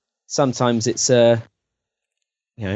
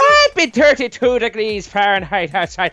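put-on higher, louder Irish accent; mock Irish lilt; 9 semitones higher, 5 dB louder than usual. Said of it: "why is he Irish".

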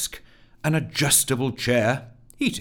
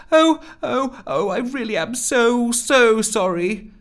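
high, frantic apology; squeaky, desperate pleading; 13 semitones higher, 6 dB louder than usual. Acted. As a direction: plead for forgiveness, high and drawn out at the end. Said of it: "pleaded Rusty".

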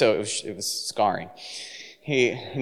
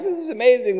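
much higher, thrilled exclamation; excited kids; 12 semitones higher than usual. Retold or imitated. Kids marvel at five hundred live chickens, much higher and excited. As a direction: squeal it with delight.

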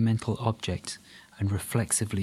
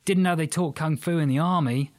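petulant; 6 semitones higher, 3 dB louder than usual. Acted. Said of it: "He sounded almost petulant".

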